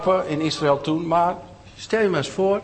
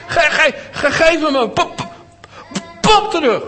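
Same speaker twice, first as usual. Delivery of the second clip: very high, loud stuttering demand; angry stutter; 12 semitones higher, 6 dB louder than usual. A narrator's reading. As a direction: stammer it out, upset. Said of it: "dan begon ze een beetje te stotteren".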